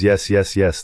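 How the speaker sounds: ordinary delivery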